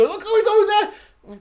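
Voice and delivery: high, slow, panicked mock cry; hammed-up frightened shout; mock alarmed trooper. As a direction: play a startled stormtrooper spotting him. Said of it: "and then go".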